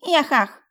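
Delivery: put-on high, loud gleeful baby squeal.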